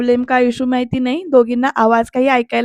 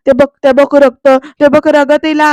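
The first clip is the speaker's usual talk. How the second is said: loud alarmed cry about the blood; retold shouting; 3 semitones higher, 7 dB louder than usual. Bayo shouts out when she sees the blood. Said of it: "असं म्हणत ना ओरडते".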